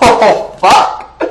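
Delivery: high, loud cry of exasperation; exasperated outburst. Real, not acted. Ranter fed up with people's bad thinking, exasperated. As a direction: burst out in real exasperation.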